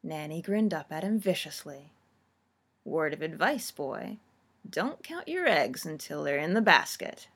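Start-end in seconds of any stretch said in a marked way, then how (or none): 2.8-7.3 put-on low, knowing old crone; lightly voiced wily granny; sly old woman. A narrator's reading. Nanny grinning viciously.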